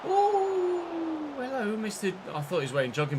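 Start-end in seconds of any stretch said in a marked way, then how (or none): 0.0-1.4 long high-pitched "ooh"; very high, held ooh; high drawn-out ooh. Real, not acted. Reacting during the match.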